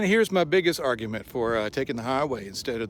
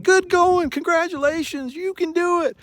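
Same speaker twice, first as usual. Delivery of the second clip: much higher, louder cheerleading; cheering; 8 dB louder than usual. Retold or imitated. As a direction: cheer like a marathon fan. Couple cheering him on.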